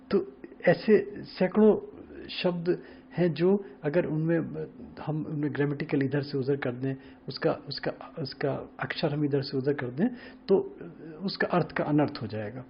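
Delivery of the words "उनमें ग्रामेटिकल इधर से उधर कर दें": ordinary delivery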